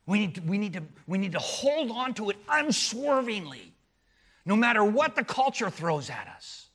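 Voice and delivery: loud, high, driving sermon voice; fiery pulpit proclamation; preaching at full force. Acted. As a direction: hammer the point home to the congregation.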